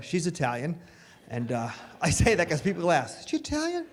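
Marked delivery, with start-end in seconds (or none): 3.2-3.9 much higher, quieter voice; mock nosy asker. Strangers asking about his daughter, nosy question.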